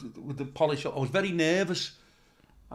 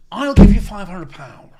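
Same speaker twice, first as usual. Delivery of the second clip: generous offer; 3 semitones higher, 15 dB louder, slower than usual. Retold or imitated. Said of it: "he said".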